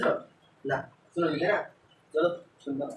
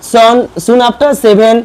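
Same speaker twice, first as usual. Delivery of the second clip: slow, loud English read for the class; slow classroom reading; 6 semitones higher, 14 dB louder, much slower than usual. Shown as a demonstration. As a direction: read each word out slowly and clearly. Teacher reads English text aloud.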